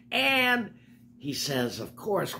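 usual speaking voice

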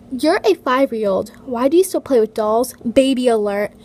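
loud, jeering read of the comment; loud mock comment read. Reading a mean comment aloud.

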